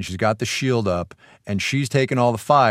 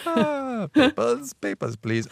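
hammed-up German accent, much higher on papers; a mock German officer demanding papers; bad German accent; 13 semitones higher, much slower than usual. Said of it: "cannot do a German accent".

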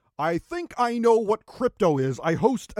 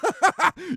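high, put-on bro laugh; showy laugh; 8 semitones higher than usual. Host laughing off his co-host's protest, showy laugh. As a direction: laugh it off like a cool dude.

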